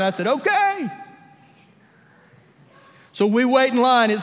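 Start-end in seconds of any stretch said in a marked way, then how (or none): 0.3-0.9 okay sliding way up high; reply in a high, rising voice; high-pitched okay. Retold or imitated. Dad answering his daughter's ride request, high-pitched okay.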